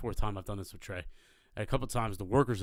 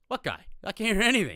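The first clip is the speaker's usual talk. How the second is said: raised, high-pitched reply; imitating a friend who talks too loud; loud deaf friend; 11 semitones higher, 7 dB louder than usual. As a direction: blurt it out like someone hard of hearing. Deaf friend answering loudly.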